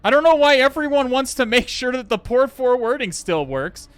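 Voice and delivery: high, loud exasperated complaining; venting real frustration at a broken setup; frustrated rant. Real, not acted. Streamer fuming to chat about the broken lab, loud and exasperated.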